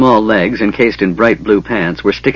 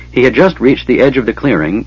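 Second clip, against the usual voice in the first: ordinary delivery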